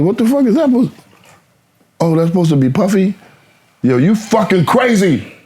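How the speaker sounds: high-pitched, incredulous reaction; retold disbelief. Telling the interviewers how he reacted to the trailer, incredulous. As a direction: react as if it's completely crazy.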